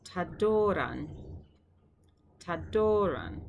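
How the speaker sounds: Tadoran said slowly, aspirated t, stress on do; slow Greek model word. Shown as a demonstration. Teacher modelling word for students, slow careful pronunciation. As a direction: draw out Tadoran for learners to copy.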